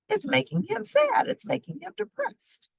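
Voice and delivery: high explaining voice. Acted.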